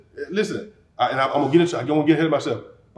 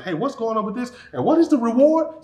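higher-pitched eager questioning; curious David; 6 semitones higher than usual. Acted. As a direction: ask around, keen to know the prize. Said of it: "David's walking around asking".

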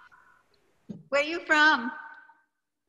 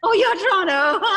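louder delighted laugh through the words; teacher laughing at a familiar name; 6 semitones higher, 7 dB louder than usual. Real, not acted. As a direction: laugh with glad recognition.

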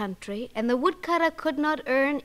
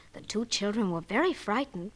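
plain reading voice

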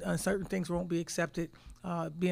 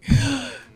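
sharp, loud, high gasp; a stunned gasp at meeting an idol; gasp of awe; 8 semitones higher, 11 dB louder than usual. Retold or imitated. Starstruck seeing Pat Summitt.

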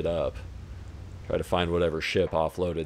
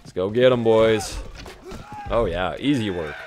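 hyped up; 2 semitones higher than usual. Real, not acted. Rallying troops in game.

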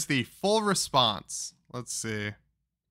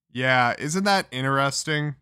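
ordinary talk, nothing marked

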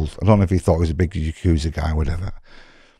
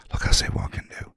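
hushed quote; 7 dB quieter, faster than usual. Retold or imitated. Guard quietly promising help.

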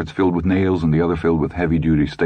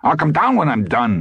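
irritated retort, pitched up; testy, higher-pitched husband; angry; 10 semitones higher than usual. A narrator's reading. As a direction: snap back at a nagging wife. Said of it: "he said angrily".

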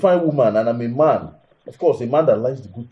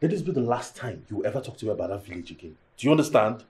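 usual speaking voice

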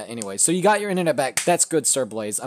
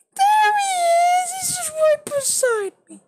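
cutesy cat voice; 4 dB louder, slower than usual. Real, not acted. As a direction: coo it sweetly to the kitty. Showing his cat to chat.

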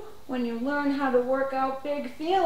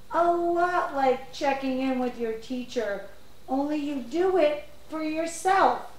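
ordinary talk, nothing marked

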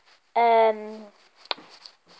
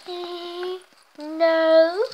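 normal talking voice

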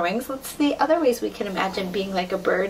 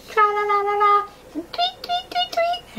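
very high chirpy tweets; birdsong; 11 semitones higher, 3 dB louder than usual. Pretend. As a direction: sing like a little bird. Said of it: "all different kinds of bird noises".

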